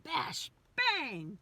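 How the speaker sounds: usual speaking voice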